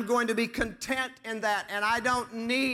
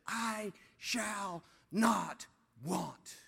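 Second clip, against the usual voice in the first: very slow, softening, word by word; drawn-out verse; 6 dB quieter, much slower than usual. Acted. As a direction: let each word of the verse stand alone. Slow hushed climax of the quoted verse.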